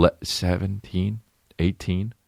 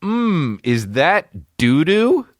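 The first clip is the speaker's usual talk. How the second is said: slow, higher put-on voice, sniffing; sniffing goofy voice; 10 semitones higher, 3 dB louder, slower than usual. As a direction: sniff the air and ask suspiciously. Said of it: "in quotes, he writes".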